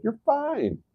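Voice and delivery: a high, airy mock reassurance; imitated doctor. Doctor to a client with symptoms, lightly imitated high reassurance. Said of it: "So the doctor said".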